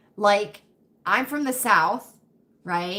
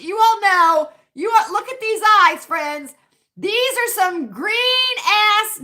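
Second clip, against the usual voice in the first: high, loud hammed-up sassy voice; over-the-top diva; 11 semitones higher, 7 dB louder than usual. Confessing to friends about her judgmental eyes, loud hammed-up diva. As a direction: camp it up big and shrill-high.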